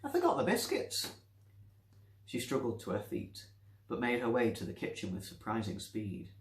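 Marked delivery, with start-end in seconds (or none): 0.0-1.1 put-on high, thin old woman's voice; old Nancy voice. Old Nancy calling out to her visitor. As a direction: play the forgetful old lady.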